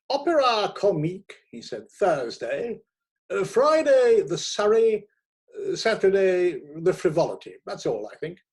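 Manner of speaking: high, loud rattling off of dates; brisk editor. A narrator's reading. Editor hands the new critic his tickets, loud and brisk. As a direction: boom out the tickets like orders.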